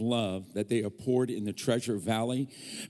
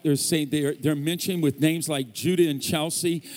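ordinary delivery

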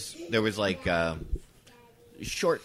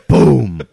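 loud, falling boom; imitated wall pounding; 5 semitones higher, 18 dB louder than usual. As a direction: boom it out like a fist hitting a wall.

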